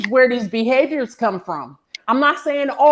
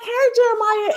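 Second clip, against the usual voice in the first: high, sing-song fake-friendly greeting; mock the overly sweet neighbor; syrupy high hello to a child; gushing woman; 12 semitones higher than usual. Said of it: "she'd be like".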